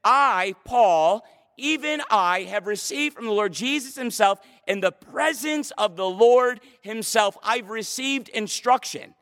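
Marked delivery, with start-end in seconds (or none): 0.0-7.4 forceful declaration, weight on "I" and "himself"; emphatic. Shown as a demonstration. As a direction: hit "I" and "Paul" hard, then press on insistently. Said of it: "the idea in the language is this".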